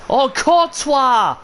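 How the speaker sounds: high, slow groan of dismay; real dismay; dismayed cry. Real, not acted. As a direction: cry out at the keeper's blunder. Keeper blunder in play.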